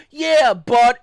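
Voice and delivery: mock the customer who won't listen; a customer's loud protest; whiny customer. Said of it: "and they go".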